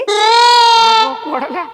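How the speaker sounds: long, high, loud wailing call; drawn-out wail. Acted. Uncle calling to his daughter-in-law who doesn't know him, long high wail.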